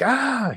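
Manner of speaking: a sudden, high mock yelp of pain; retold cry of pain. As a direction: yelp as if the shoulder just flared up. Pain triggered by shoulder tilt.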